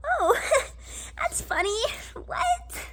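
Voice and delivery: high-pitched, thrown reaction with a little laugh; awkward, high "this can't be happening" voice; nervous disbelief. Retold or imitated. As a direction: squeak it out in mock-amused disbelief. Acting disbelief at declined card.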